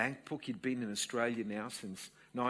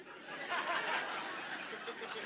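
breathy laugh; a soft, unvoiced laugh; airy laugh; 14 semitones higher, 6 dB quieter than usual. Real, not acted. Laughing while telling story.

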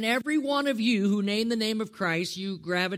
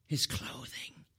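low, slow, near whisper; hushed repeat; 4 semitones lower, 8 dB quieter, slower than usual. Real, not acted. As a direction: drop it right down to a hush. Same answer repeated hushed.

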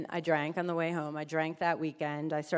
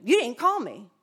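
louder, higher scolding sponsor; 5 semitones higher, 9 dB louder than usual. Retold or imitated. Sponsor phones to scold the newcomer, louder. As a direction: chide her sharply.

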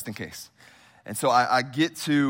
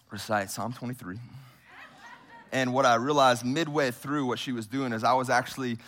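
ordinary talk

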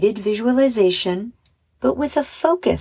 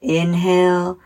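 measured meditation voice with long pauses; unhurried guided relaxation, phrase by phrase; 2 semitones lower than usual. Acted. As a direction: guide children gently through breathing and imagery. Leads a guided visualization.

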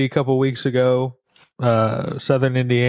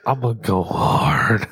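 husky whispered growl; raspy whisper; 3 semitones lower than usual. Acted. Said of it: "That sexy, raspy voice".

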